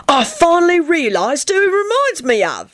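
high, excited realisation; enthusiastic. A narrator's reading. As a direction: blurt it with rising delight. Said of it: "he said enthusiastically".

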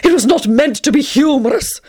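high, sulky and offended; petulant. Acted. Tony sulking at Teddy's laughter, petulant and high. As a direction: pout the line. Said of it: "in a petulant manner".

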